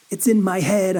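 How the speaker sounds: usual speaking voice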